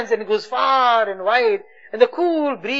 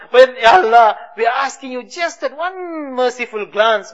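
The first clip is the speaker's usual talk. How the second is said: ordinary delivery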